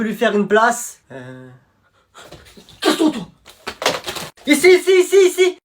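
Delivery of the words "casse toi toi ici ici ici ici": very high, loud calling; shouting over each other. Acted. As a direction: yell to grab her attention. Boys fight for her seat.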